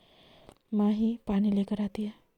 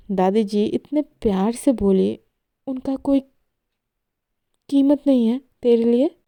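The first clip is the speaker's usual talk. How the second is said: angry reproach; 2 semitones higher, 6 dB louder than usual. Acted. Mahi scolds Esha over grandma.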